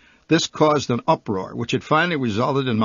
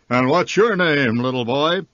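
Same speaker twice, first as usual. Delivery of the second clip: booming Santa; 3 dB louder than usual. A narrator's reading. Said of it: "his booming baritone crashed out".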